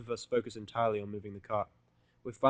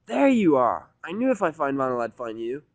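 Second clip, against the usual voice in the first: much higher, loud, brash tomboy shout; Rainbow's voice; 4 semitones higher, 11 dB louder than usual. Acted. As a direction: burst in triumphantly. Rainbow bursting in on Seth.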